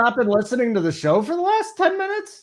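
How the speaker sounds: high-pitched, exasperated disbelief; incredulous scolding. Real, not acted. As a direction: call out a co-host who wasn't paying attention. Host scolds inattentive co-host.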